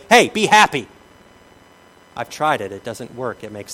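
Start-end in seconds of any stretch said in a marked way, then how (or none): 0.1-0.9 loud, high chipper "Hey, be happy"; mock cheery order. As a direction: bark it brightly at a sad person. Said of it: "walk up to a sad person and say".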